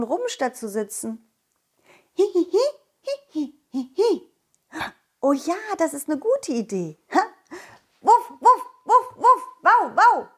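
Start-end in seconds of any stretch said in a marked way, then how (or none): 2.2-4.2 high giggle; giggly sneering imp. Acted. Ball character giggles. 8.0-10.3 high loud woof woof; loud barking. Acted. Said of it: "Ich bellte laut".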